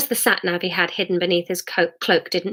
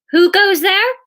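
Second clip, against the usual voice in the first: high, loud sentry challenge; challenging call; 12 semitones higher, 6 dB louder than usual. A narrator's reading. Hidden villager challenges a party from behind a hedge, loud call. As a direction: call it out sharply to unseen intruders.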